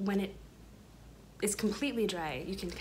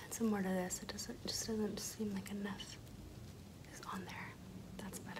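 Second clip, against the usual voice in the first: whispered working aside; whispering; 8 dB quieter than usual. Real, not acted. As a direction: murmur it under your breath. Murmuring to herself while working.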